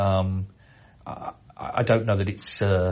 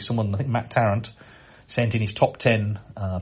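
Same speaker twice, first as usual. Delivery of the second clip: ordinary delivery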